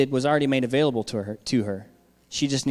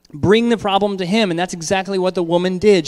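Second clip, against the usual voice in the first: louder, higher preaching climax; raised preacher's voice; preaching at full force; 6 semitones higher, 5 dB louder than usual. Real, not acted. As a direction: drive the point home to the church. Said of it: "preaching climax".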